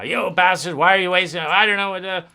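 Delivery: a hint of a sulky teen lashing out; mock angry teenager. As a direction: snap at them like a fed-up adolescent. Voicing frustrated inner adolescent.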